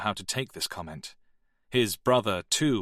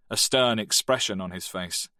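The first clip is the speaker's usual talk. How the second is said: plain reading voice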